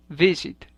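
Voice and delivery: visit with the first syllable stressed. Shown as a demonstration. Teacher showing learners the accent in visit, first-syllable stress. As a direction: say visit as VI-sit. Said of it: "tiene el acento en la primera sílaba".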